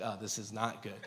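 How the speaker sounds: very soft, low aside; quiet dread. Retold or imitated. Husband seeing the pregnancy test, quiet dread. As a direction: drop right down in muted dismay.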